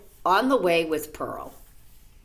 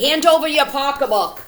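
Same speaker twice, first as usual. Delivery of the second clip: higher, louder robber threat; robbers' demand; 7 semitones higher, 7 dB louder than usual. A narrator's reading. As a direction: bark it like a mock robber. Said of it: "said the robbers".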